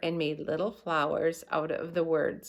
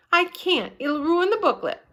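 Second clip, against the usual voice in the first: high, loud refusal; insist, on the edge of tears; upset girl; 11 semitones higher, 6 dB louder than usual. A narrator's reading.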